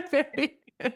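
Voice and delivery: laughing. Real, not acted. S1 laughing at the answer.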